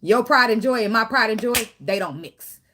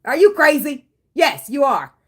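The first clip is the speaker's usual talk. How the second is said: mock scolding; 6 semitones higher, 8 dB louder than usual. Scolding the avoidant partner.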